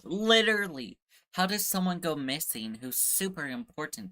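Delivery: loud, high burst of frustration; exasperated outburst. Real, not acted. Player venting about the missing villager, loud and fed up. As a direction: blurt it out fed up.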